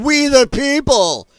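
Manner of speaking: high, loud, slow mock chant of the crowd; sneering slow imitation of a yelled slogan. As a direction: drag it out like a rowdy protester. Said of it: "Somebody yelled in the background".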